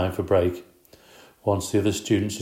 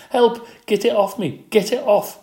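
very high, loud panicked scream; 14 semitones higher, 6 dB louder than usual. Acted. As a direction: shriek as if a bug is in her hair. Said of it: "she screamed".

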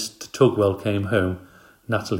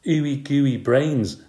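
slow, high, drawn-out teasing; spooky teasing; 6 semitones higher, 4 dB louder, much slower than usual. A narrator's reading. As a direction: stretch out each gooey word. Boy teases with gross words.

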